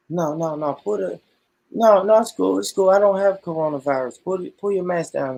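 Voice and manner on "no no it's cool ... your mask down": higher, louder mock charm; playing a flirt coaxing a masked woman; hammed-up smooth talker. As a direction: coax her playfully. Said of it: "That ain't gonna work".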